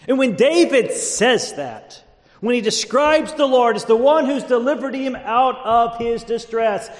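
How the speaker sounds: loud, high, ringing sermon voice; fired-up preacher at the pulpit; full-force preaching. Real, not acted. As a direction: proclaim it to the whole congregation.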